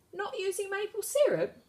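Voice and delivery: high, shocked question; shocked wizard. A narrator's reading. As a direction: gasp it out in disbelief.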